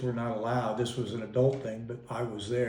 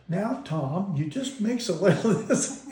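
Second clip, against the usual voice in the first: sweet teaching; 6 semitones higher than usual. Retold or imitated. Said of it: "she'd be nice and sweet".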